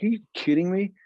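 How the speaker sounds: high incredulous question, retold; retold disbelief. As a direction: replay being amazed by a friend's news. Retold disbelief at friend's news.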